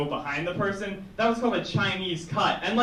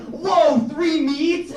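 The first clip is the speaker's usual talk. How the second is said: high, loud whoa of wonder; hammed-up astonishment at the sandwich; mock amazement; 9 semitones higher, 5 dB louder, much slower than usual. The crowd marvelling at the new hoagie, in mock amazement. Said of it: "And we were like".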